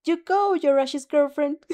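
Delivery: loud, high cheer through cupped hands; shout. Acted. A girl cheering at the TV through her hands, loud shout. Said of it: "shout to the T V".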